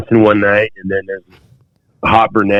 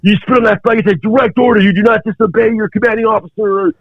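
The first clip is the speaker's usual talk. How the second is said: furious drill instructor; 12 semitones higher than usual. Retold or imitated. Drill instructor threatens court martial, furious shouting. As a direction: roar the threats at the recruit.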